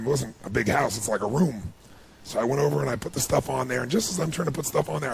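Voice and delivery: usual speaking voice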